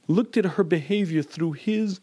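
usual speaking voice

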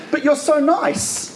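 can't square it, said slowly; incredulous surprise. Retold or imitated. Rabbi marvelling at the kind satanist, slow surprise.

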